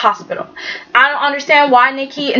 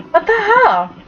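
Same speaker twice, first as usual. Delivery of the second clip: much higher, incredulous "what the hell"; exasperated disbelief; 9 semitones higher than usual. Real, not acted. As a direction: throw it up in disbelief. Exasperated at soap plot.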